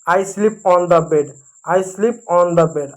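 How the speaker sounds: very slow, twice over, each word clear; slow model sentence. Shown as a demonstration. Teacher repeating the sentence for children, very slow. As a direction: drill it slowly for beginners. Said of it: "बिस्तर की अंग्रेजी होगी bed.".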